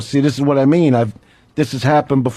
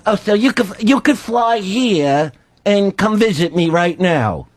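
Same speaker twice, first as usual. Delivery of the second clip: slow, raised-pitch credulous agent imitation; Dennis; 5 semitones higher than usual. Agent asking Superman to visit now, slow high imitation. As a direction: ask it wonderingly, drawn out and higher. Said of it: "So he goes".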